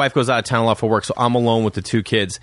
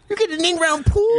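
much higher, astonished; kid amazement. Retold or imitated. Kids hearing a friend got a pool, astonished. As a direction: gasp it out like a wowed kid.

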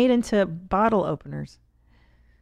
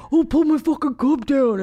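loud, high mock complaint; Tom; 6 semitones higher, 4 dB louder than usual. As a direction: imitate an indignant outburst, loudly. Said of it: "And then Tom will be like".

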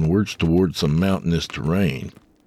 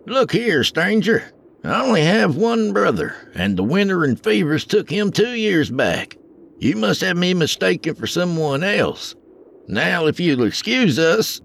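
high, wary frontier driver; gruff wagon driver; 13 semitones higher than usual. A narrator's reading. Wagon driver turns away a stranger, wary and high. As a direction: brush off the stranger.

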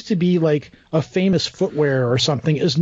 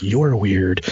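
much lower voice, mimicking the locals; suspicious congregation; 8 semitones lower than usual. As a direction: drop low for a wary sideways judgment. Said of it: "and they're like".

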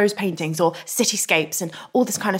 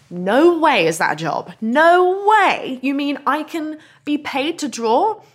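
disbelieving; 5 semitones higher, slower than usual. Retold or imitated. Retold disbelief at a dream job.

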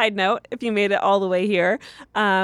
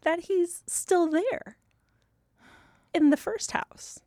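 high quote; 11 semitones higher, 4 dB quieter than usual. Retold or imitated. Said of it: "I'm like".

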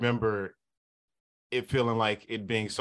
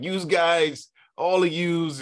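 imitating a thick Boston accent, higher and louder; 8 semitones higher, 5 dB louder, slower than usual. Nephew imitating his Boston uncle's greeting, in a mock Boston accent. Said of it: "he never lost his Boston accent".

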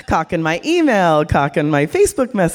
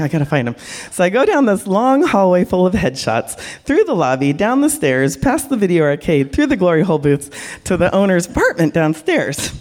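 ordinary delivery

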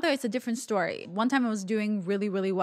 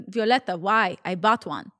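put-on protesting Russian-accented mother; mock Russian mom, mildly exasperated; poor Russian accent; 4 dB louder than usual. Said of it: "my really poor Russian accent".

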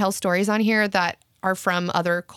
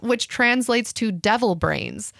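ordinary delivery

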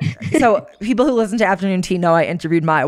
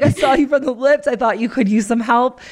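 imitating the helpful woman; 6 semitones higher than usual. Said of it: "so she's like".

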